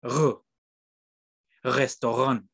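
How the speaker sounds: R and Restaurant with a guttural French R. Shown as a demonstration. Teacher giving students a French R example word. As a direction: roll the R from the back of the throat in Restaurant. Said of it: "la estrategia para imitar este sonido R".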